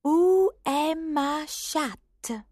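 high, slow careful French phrase; high, slow girl's schoolbook French. A narrator's reading. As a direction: sound out the textbook French slowly. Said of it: "words from her French grammar book".

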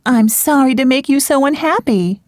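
put-on grieving young woman. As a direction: apologise through heartbreak.

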